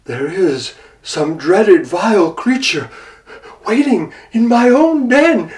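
a tiger panicking at his den; frightened tiger. Acted. Tiger realising danger at his den, growing fear.

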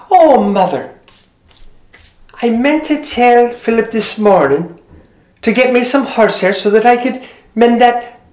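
a cheerful daughter speaking to her mother; Maureen's voice. Acted.

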